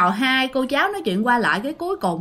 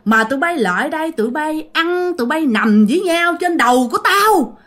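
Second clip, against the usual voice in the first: imitated scolding, higher-pitched throughout; acted-out high, indignant telling-off, louder at the end; scolding old woman; 6 semitones higher, 3 dB louder than usual. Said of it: "một cái bà già bà chửi".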